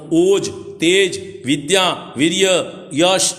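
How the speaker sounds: "ઓજ, તેજ, વિદ્યા, વીર્ય, યશ" slowly, item by item; slow, deliberate repetition. Shown as a demonstration. Preacher repeats the scripture's list for listeners, slowly spacing "ઓજ, તેજ, વિદ્યા, વીર્ય, યશ". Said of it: "ફરીથી બોલું".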